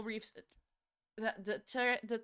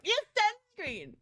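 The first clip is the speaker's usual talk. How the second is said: much higher, slow, drawn-out cheer; mock cheer; 11 semitones higher, 5 dB louder, slower than usual. Cheering at Spyro for using sunscreen, high and drawn out. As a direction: celebrate like a proud coach. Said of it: "I was just like".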